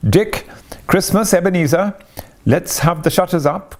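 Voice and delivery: high hearty call; jovial cry. A narrator's reading. Fezziwig urging Dick and Ebenezer, jovial cry. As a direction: cheerfully rouse the apprentices. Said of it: "cried old Fezziwig".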